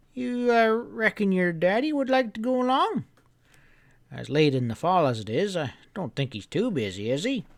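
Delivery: put-on an old storekeeper giving friendly orders; old grandpa voice. Grandpa telling the boy to bring the hounds early, folksy. Grandpa asks about the father.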